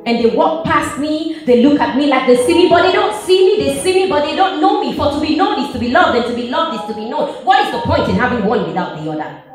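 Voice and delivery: urgent rapid-fire lament; rushed frustration. Acted. Pours out frustration at being unseen.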